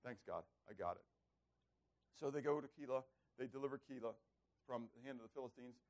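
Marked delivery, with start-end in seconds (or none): 0.0-1.0 low, soft acknowledgement; subdued, brief reply; David. Acted. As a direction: give in quietly, lesson learned. David's meek reply after rebuke.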